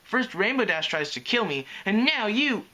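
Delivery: high-pitched aggrieved complaint; grumbling. A narrator's reading. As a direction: grumble like a victimised kid, rising on the accusation. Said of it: "he grumbled".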